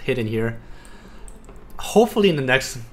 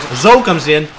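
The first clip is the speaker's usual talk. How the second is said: excited; 6 semitones higher, 6 dB louder than usual. Real, not acted. Tense moment in the fight.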